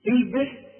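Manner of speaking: on الباء, bounce the ba with a short echo; الباء said with the echoing qalqala. Shown as a demonstration. Tajweed teacher demonstrating الباء. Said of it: "بنقلقل الباء".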